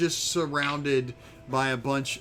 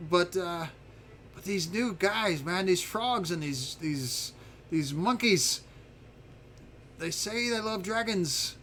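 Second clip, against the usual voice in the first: higher-pitched character voice, complaining; aggrieved little dragon; 4 semitones higher than usual. Acted. Little dragon complaining to visitors about the new guys, higher aggrieved voice. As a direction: play a put-out, self-important dragon.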